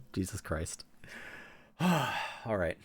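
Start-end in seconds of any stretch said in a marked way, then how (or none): none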